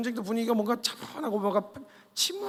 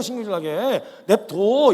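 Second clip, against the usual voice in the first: drawn-out, slower warning; 3 semitones higher, 6 dB louder, slower than usual. Acted. Soldiers warning David to leave Goliath alone, slowly. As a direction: stretch it out as a warning.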